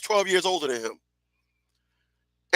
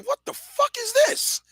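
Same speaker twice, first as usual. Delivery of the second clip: put-on squeaky, very high shocked kid voice; a nine-year-old stunned by the sign; shocked child; 4 dB quieter than usual. Shocked kid at aunt's kitchen sign, very high squeak.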